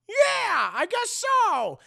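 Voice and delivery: high, loud monster voice; Eon. Acted. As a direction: shout it as the big villain. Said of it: "Eon's like".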